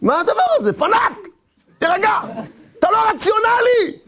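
high, exclaimed scolding; exasperated outburst. Acted. Critic telling the fanatic to calm down, exasperated outburst.

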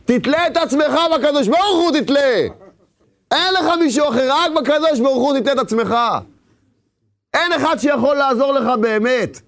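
high, fervent rebbe's reply; the rebbe's answer. Retold or imitated. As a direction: proclaim it like the rebbe, lifted high. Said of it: "מה הוא התכוון".

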